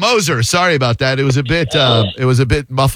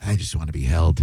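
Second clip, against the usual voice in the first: low, soft little confession; quietly, as a mock-vulnerable aside; mock needy plea; 8 semitones lower, 7 dB quieter than usual. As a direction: imitate a tough guy secretly wanting comfort. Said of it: "gets off the air and he's like".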